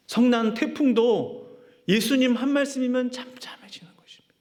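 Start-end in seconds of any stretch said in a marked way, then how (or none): 3.1-4.4 hushed, awed whisper, pitched up. Real, not acted. Preacher whispering to the congregation about the stilled storm. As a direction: let the words fall to a breathless whisper.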